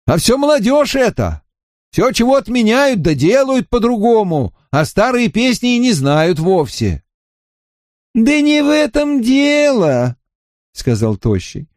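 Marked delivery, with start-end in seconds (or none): 0.1-7.0 put-on high-pitched cranky old man; grumbling angrily. A narrator's reading. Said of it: "сердито сказал он". 8.1-10.2 high, slow shouting protest. A narrator's reading. Villager cuts in loudly.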